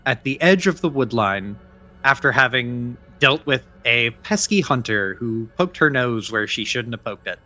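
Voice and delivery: measured scene-setting read; game master narration. Acted. Game master sets the dusk scene, narrating calmly. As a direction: set the night scene for players.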